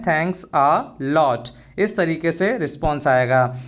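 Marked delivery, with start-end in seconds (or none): 0.0-1.5 slow, carefully spelled-out English phrase; slow and clear classroom model phrase; slow teaching demo. Shown as a demonstration. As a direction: say each word slowly for the learner. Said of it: "या बोल सकते हैं".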